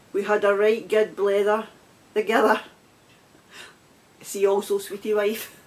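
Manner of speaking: slow, careful reading voice; reading aloud. Acted. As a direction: read the mug's entry out like a definition. Reads the mug's dictionary entry.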